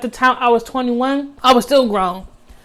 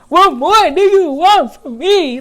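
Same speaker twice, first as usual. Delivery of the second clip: higher, louder pleading croon like [name]; a light [name]-style plea, pitched up; [name] impression; 6 semitones higher, 4 dB louder than usual. Quoting her own plea to the ex in [name]'s voice. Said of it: "in my [name] voice".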